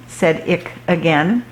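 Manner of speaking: usual speaking voice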